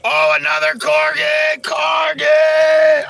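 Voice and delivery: very high, loud excited yell; excited shout. Real, not acted. Streamer greeting another corgi, high shout. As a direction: scream it with delight.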